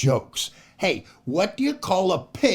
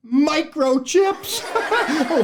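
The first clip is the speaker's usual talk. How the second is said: slow, high punchline dissolving in laughter; laughing punchline; 12 semitones higher, 3 dB louder than usual. Real, not acted. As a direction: deliver it slowly, then laugh.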